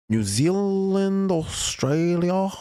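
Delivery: put-on a terrible stab at an Australian accent, dragged out; bad Australian accent. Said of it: "That was a terrible accent".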